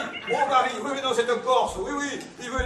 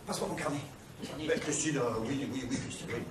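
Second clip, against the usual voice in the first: hushed aside; 9 semitones lower, 13 dB quieter than usual. Acted. Aparté pendant l'appel.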